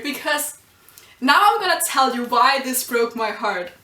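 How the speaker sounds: ordinary delivery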